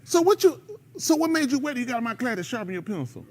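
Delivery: lightly voiced scolding teacher; mild mock teacher scolding; a hint of a teacher. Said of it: "They be like".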